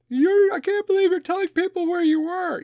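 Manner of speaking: very high-pitched mocking voice; mock scandalised objector. Imagined critic scolding a veteran, high mock outrage. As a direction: squeal it in fake outrage.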